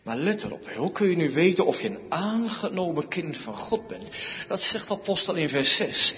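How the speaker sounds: ordinary talk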